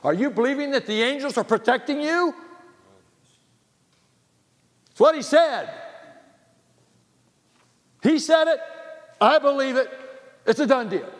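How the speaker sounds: high, loud triumphant preaching; preaching at full force. Acted. Preacher declaring the angels protect them, loud and triumphant. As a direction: proclaim it as settled fact.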